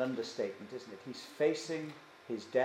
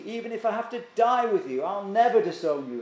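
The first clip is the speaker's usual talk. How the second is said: loud, raised, emphatic vow; emphatic insistence; 5 semitones higher, 6 dB louder than usual. Acted. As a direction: insist forcefully, pushing the voice up. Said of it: "he insists emphatically".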